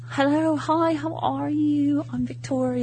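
put-on English celebrity greeting, soft; slow, softly voiced English star. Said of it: "And she says".

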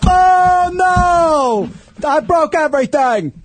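high, drawn-out mock wail; hammed-up tantrum. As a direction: cry out in exaggerated dismay, voice way up. Re-enacts breaking the keyboard.

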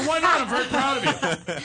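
loud, high, insistent dad; indignantly proud father. Retold or imitated. Father answering his reluctant son, loudly proud. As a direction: protest with over-the-top fatherly pride.

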